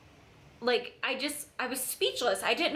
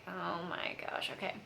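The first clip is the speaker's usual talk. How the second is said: whispered dismay; 4 semitones lower, 10 dB quieter than usual. Retold or imitated. Seeing the Mary Kay card, whispered dismay. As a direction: breathe it out under your breath.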